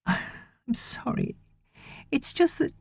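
soft, breathy and tearful; a mild tearful sorry; ashamed wife, near tears; tearful apology. A narrator's reading.

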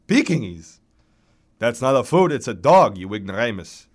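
put-on loud, scornful old baritone; indignant old man, loud then dropping off; hoarse old man. A narrator's reading. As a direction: correct a fool with cantankerous disdain. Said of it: "hoarse, tattered remnants of an operatic baritone".

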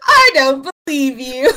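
loud, slow, through laughter; laughing disbelief. Real, not acted.

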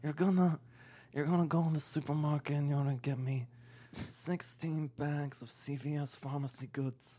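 mumbled movie godfather voice; Marlon Brando. Acted. Podcaster taking a turn at impressions, Brando godfather voice. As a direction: put on an ageing mob boss's voice. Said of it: "do Marlon Brando impressions".

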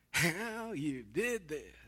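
slow, drawn-out sarcastic jab; sarcasm. Retold or imitated. Preacher imitates a wife's greeting at the door, sarcastically. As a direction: drag the words out in mock admiration. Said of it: "can't even do the level of sarcasm".

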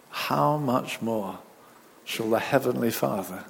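soft, hushed prayer voice. Acted. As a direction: pray gently, close and intimate. Leads an opening prayer.

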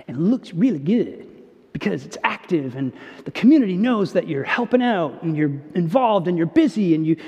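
normal talking voice

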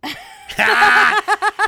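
sudden loud high-pitched burst of laughter. Real, not acted. Laughs at nephew's answer.

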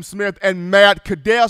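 normal talking voice

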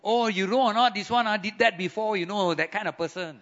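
Singlish gossip, lightly voiced; Singaporean accent.

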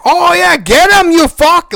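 high, loud cheering jeer; mock battle cry. Voicing the heroes ganging up on Starro, high loud battle jeer. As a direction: egg the fighters on, hammed up.

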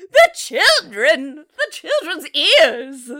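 loud, slow hysterical wail; hammed-up hysterical mother; mock wail. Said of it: "she's like".

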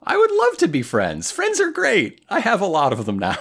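high-pitched, hammed-up eagerness; eager good boy. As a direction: gush with mock-innocent glee, high then quickening. Plays the boy gushing about friendship.

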